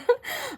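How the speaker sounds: giggle. Real, not acted. Laughs reading boss's card.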